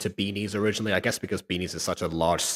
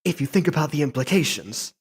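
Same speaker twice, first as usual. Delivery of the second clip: high, theatrical mock delivery; dramatic character voice; 4 dB louder than usual. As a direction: ham it up in a high voice. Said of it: "you get the idea with voices".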